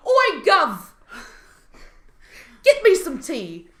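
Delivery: loud, high mock-British holler; terrible British accent. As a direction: butcher a British accent, loudly and playfully. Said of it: "Terrible accents. at the British accent".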